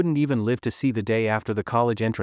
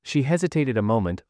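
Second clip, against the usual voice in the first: plain reading voice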